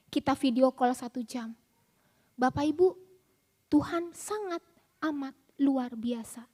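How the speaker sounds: ordinary talk, nothing marked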